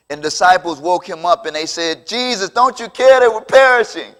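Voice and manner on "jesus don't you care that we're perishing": high, loud desperate cry; urgent mock outcry; alarmed plea. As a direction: act out panicked disciples waking their leader. Disciples wake Jesus in the storm.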